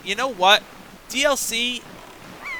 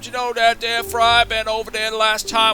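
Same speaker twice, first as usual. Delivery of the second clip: put-on reminiscing southern old codger; old fogey; 3 semitones higher than usual. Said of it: "he's an old fogey".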